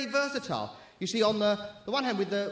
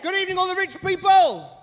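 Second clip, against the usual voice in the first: high, loud call to the crowd; rousing shout; 8 semitones higher, 6 dB louder than usual. Real, not acted. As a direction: shout it out to rouse the audience. Demands louder response from audience.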